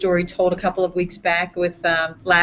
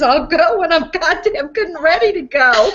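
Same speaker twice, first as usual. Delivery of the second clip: cranky grandma; 8 semitones higher, 3 dB louder than usual. Retold or imitated. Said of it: "sits halfway up and says".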